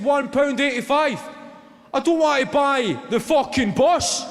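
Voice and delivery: high, loud exasperated rant; losing it. Retold or imitated. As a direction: blow up at the driver. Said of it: "the guy just lost it".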